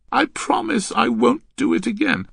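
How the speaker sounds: put-on high, loud girlish promise; pleading girl. Girl placating the angry man, pleading.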